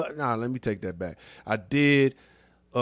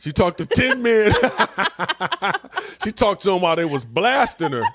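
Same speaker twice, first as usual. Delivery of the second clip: loud and higher, words breaking into laughter; cackling at the joke; laughing; 7 semitones higher, 4 dB louder than usual. Real, not acted. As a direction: crack up while repeating the punchline.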